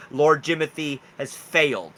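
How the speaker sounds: ordinary talk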